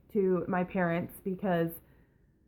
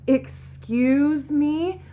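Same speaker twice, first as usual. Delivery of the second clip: imitated affronted attitude, drawn out slowly; slow, higher, rising mock offence; snotty attitude; 4 semitones higher, 4 dB louder, much slower than usual. Phone rep to complaining customer, mimicked snotty attitude. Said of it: "just like that attitude".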